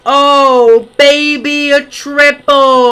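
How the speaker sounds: loud high hype; excited shout. Real, not acted. Gamer celebrating a lucky find, loud excited. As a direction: yell it in triumph.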